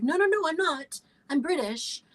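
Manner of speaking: retold high-pitched denial; insistent protest. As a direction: protest your case, voice jumping up. Retold protest to casting director.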